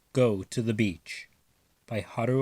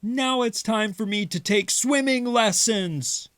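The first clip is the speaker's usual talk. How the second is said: joking Father; 10 semitones higher than usual. Acted. Father voice high.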